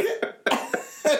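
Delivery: squealing laugh up high. Real, not acted. Host cracking up at the story, high squealing laugh. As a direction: burst into a shrieking giggle.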